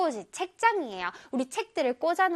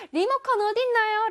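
calling out a search; 8 semitones higher, faster than usual. Acted. Acted question hunting for remote.

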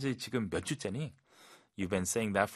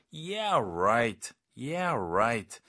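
emphatic, stretched sarcasm; forceful, swooping "yeah, right"; strong disbelief; much slower than usual. Shown as a demonstration. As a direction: push the disbelief hard on both words. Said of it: "좀 더 강하게, 진짜 믿기 힘들 때".

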